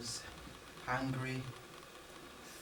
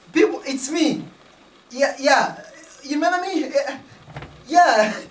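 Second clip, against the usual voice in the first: calling out; 4 semitones higher, 6 dB louder than usual. Retold or imitated. Calling to hiding villagers.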